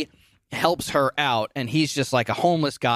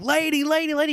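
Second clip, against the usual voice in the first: high strained calling voice; homeless guy calling. Retold or imitated. Homeless man chasing Tess, calling lady in a high voice. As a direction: call after her like a desperate stranger. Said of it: "he's like".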